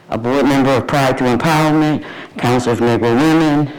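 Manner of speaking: ordinary talk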